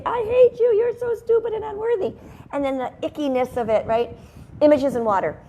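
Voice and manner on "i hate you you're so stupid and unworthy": high, loud mock-hateful jeer. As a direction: sneer it out, pitched way up. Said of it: "the ones that say".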